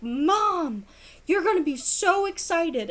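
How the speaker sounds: hint of a thrilled kid bursting with news; excited eight-year-old son. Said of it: "and he's like".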